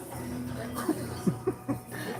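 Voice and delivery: soft, high, breath-only chuckle; quiet laugh at your own tall tale; amused laugh. Real, not acted. Speaker laughing after his admiral story, amused.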